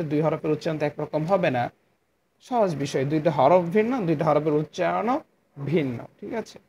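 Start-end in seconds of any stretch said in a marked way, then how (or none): none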